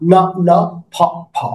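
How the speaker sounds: usual speaking voice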